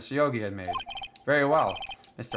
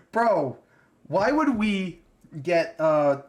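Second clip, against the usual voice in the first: louder, higher exasperated outburst; pissed rant; 5 semitones higher, 4 dB louder, much slower than usual. Real, not acted. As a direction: vent the outrage loud at the game. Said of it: "Oh, I'm so pissed".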